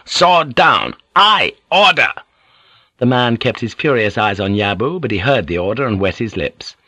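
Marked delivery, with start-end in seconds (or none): 0.1-2.1 high, loud orders; forceful, punched-out commands; shouted. A narrator's reading. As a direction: command him to disarm at a shout. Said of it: "Blackthorn shouted".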